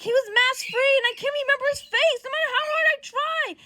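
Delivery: loud, high frustrated rant; upset. Acted. Johnny unable to remember Peter's face, upset high rant. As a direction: raise pitch and volume in frustration. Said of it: "And he's upset".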